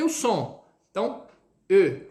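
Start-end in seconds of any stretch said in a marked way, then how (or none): 1.7-2.0 "E" loud, lips lightly rounded; teacher modelling the e; French e. Shown as a demonstration. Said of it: "E, com um leve biquinho".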